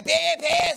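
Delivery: high loud honk on pie; mimicking a car horn; car horn imitation. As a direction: honk like an impatient driver. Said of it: "Sonar la bocina".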